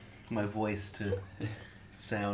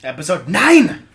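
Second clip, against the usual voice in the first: loud hype announcement; hyped shout; 6 semitones higher, 17 dB louder than usual. Acted. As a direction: shout it like a host. Podcast intro, shouted.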